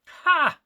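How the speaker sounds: sudden high, loud nervous bark of a laugh; a burst of jittery laughter; nervous blurted ha. Acted. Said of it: "Hamiel blurted out nervously".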